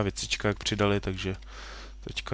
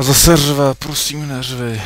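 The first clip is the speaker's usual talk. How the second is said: much louder, higher plea to stop yelling; loud pleading; 5 semitones higher, 12 dB louder, slower than usual. Real, not acted. Son shouting back at his yelling dad, loud plea. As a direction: call out over the noise: please stop.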